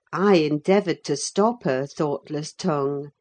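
usual speaking voice

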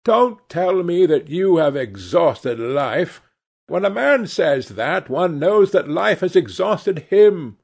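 Lady Narborough. A narrator's reading.

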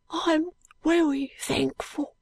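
put-on high, slow, weak street-boy voice; faint Cockney boy muttering; muttering Joe. A narrator's reading.

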